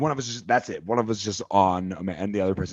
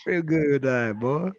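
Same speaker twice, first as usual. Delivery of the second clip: a Southern drawl on real good, a touch higher; hammed-up Southern accent; mock Southern accent; 4 semitones higher, slower than usual. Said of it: "having a Southern accent".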